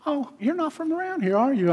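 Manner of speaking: very high, sweet Southern lady voice; high-pitched mock Southern sweetness; sweet Southern accent. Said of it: "this real sweet Southern accent".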